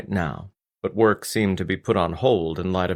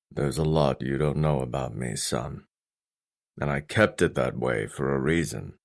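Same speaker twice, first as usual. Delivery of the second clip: low-voiced father confiding in his son; low, reserved fatherly voice; stern father, guarded; 6 semitones lower, 4 dB quieter than usual. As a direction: keep it low and weighty, holding something back.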